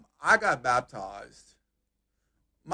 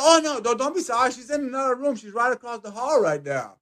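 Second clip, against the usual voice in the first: cheerful nurse; 7 semitones higher than usual. Retold or imitated. Nurse reassures him at desk.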